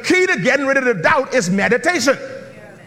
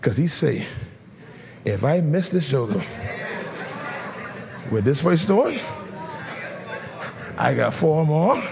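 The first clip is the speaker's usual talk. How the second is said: hushed confiding storytelling; 8 semitones lower, 5 dB quieter than usual. Acted. Sudden hush voicing David's private thoughts.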